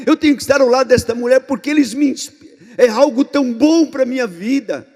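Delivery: earnest plea building to loud, high insistence; fervent devotion. Acted. Disciple declaring they need their mentor, fervent and building.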